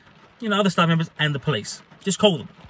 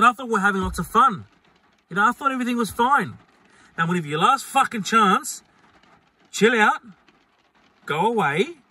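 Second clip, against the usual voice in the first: pitched-up, insistent retold telling-off; exasperated final warning; 7 semitones higher than usual. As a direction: lay down a last warning, voice raised in pitch. Retold last warning to drunk man.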